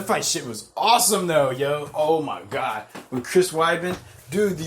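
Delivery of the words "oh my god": usual speaking voice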